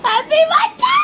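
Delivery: loud high birthday cheer; excited shout. Real, not acted. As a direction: yell it out joyfully.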